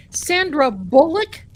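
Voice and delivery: loud, high incredulous question. Real, not acted. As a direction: scoff at the very idea. Scoffing at the casting joke.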